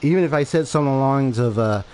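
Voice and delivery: ordinary talk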